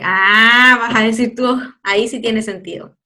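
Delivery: ordinary talk, nothing marked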